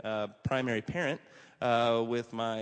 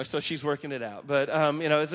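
ordinary delivery

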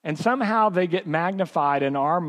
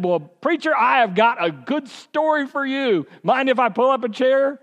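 higher, louder folksy eagerness; eager old man; 7 semitones higher, 3 dB louder than usual. Retold or imitated. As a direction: pull the preacher in with a grin. Old man invites himself.

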